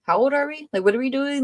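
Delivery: retold eye-rolling disbelief; exasperated. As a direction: chide them as if they were children. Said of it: "I'm just like".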